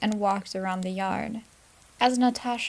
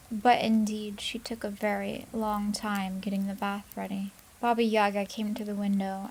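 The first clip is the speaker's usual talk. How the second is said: ordinary delivery, nothing marked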